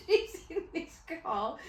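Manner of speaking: high, slow, laughing speech; giggling helplessly into the words; cracking up. Real, not acted. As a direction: struggle to talk through real giggles. Cracking up starting a stupid story.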